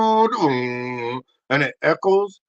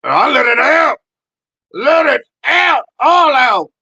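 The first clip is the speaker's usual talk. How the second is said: much louder, much higher yell; shouted advice; 6 semitones higher, 9 dB louder than usual. Acted. Urging the audience to let it all out, shouting. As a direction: bellow it out to the crowd.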